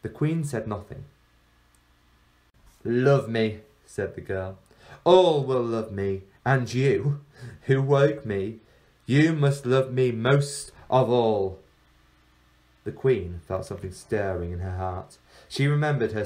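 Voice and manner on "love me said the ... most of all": louder, slow, enchanting command; commanding girl. Acted. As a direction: bind her will with the words. Girl demands love.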